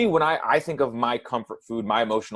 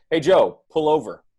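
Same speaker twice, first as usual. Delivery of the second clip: slightly raised, controlled call from the backseat; mild backseat call; 5 semitones higher, 6 dB louder than usual. Acted. Backseat passenger asks client to pull over, mild call. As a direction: call out an instruction without yelling.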